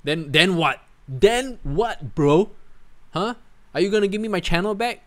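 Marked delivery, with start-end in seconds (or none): none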